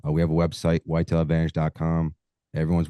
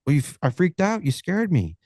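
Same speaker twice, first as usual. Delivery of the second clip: lightly voiced hunter, pitched much higher; a hint of a rattled customer, higher; the hunter complaining; 11 semitones higher than usual. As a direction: whine it like a spooked client. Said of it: "He goes".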